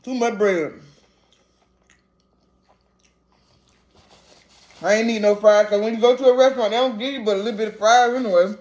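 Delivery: chewing between words; wiping mouth with napkin; mouth full. Real, not acted. Seen 3: bites burger at -7, cheeks puffed chewing through 5.8.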